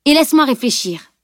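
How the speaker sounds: firm, impatient command. A narrator's reading. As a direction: demand room to think. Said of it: "ordonna-t-elle".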